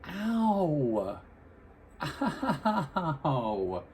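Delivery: sharp yelp of pain. Real, not acted. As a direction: cry out from a cut finger. Just sliced her finger on bit.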